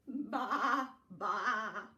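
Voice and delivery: loud, wavering baa; playful loud sheep call; sheep bleat. Acted. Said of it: "bleated the".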